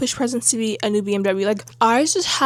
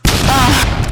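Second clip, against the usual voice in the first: exclaim; 4 semitones higher, 10 dB louder than usual. Real, not acted. Loud exclamation between topics.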